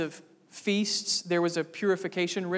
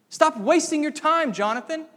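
loud, higher, contemptuous command; Saul's scorn; 9 semitones higher, 7 dB louder than usual. Retold or imitated. Saul scolding his son Jonathan, loud contemptuous voice. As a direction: bark it at Jonathan like a scheming king. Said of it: "That's what Saul says".

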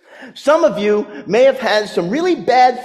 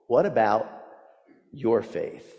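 hushed repeat; 8 semitones lower, 9 dB quieter than usual. Real, not acted. Repeated question, even softer.

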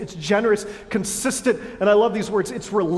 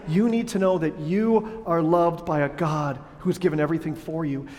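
usual speaking voice

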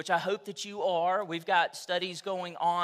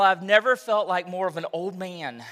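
ordinary talk, nothing marked

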